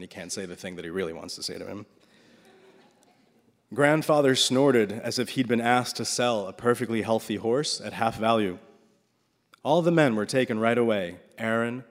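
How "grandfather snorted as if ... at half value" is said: usual speaking voice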